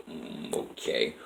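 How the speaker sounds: very soft, drawn-out reply; hesitant agreement. Retold or imitated. Dave answering Alyssa's instruction to look her up, hesitant. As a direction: go along doubtfully, low and slow.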